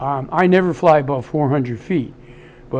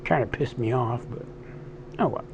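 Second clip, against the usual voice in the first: weak, tired post-surgery voice; 3 semitones lower, 8 dB quieter than usual. Real, not acted. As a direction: voice still worn from the tubes. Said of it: "My voice is still weak from the anesthesia".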